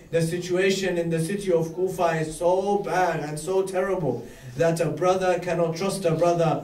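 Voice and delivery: normal talking voice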